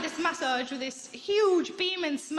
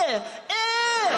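slow, louder effortful grunts; strained grunts; 5 semitones higher, 3 dB louder, slower than usual. Retold or imitated. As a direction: mimic a skinny therapist straining. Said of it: "they're doing this".

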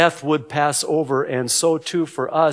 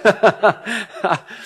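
higher-pitched laugh at own joke; amused laugh; 6 semitones higher than usual. Real, not acted. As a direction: laugh after teasing the crowd.

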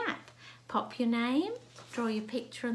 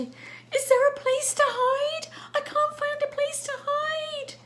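very high, loud, wailing panic; panicked cat; 8 dB louder than usual. Acted. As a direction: squeal in hammed-up fright as a chased cat. Chased cat looks for hiding place.

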